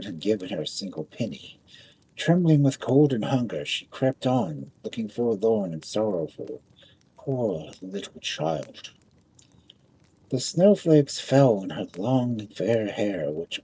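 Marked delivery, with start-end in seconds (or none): none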